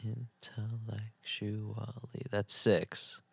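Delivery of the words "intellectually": very soft, slow intellectually; hushed syllable-by-syllable count. Shown as a demonstration. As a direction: murmur the word beat by beat. Counts the syllables under his breath.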